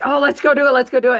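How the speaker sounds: imitating an eager colleague ready to charge ahead; excited. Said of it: "he gets very excited and like".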